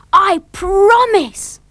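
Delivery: high, loud, drawn-out vow; eager promise. A narrator's reading. Dilly promising Father to be good, loud and eager.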